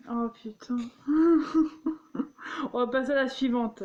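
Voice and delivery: ordinary talk, nothing marked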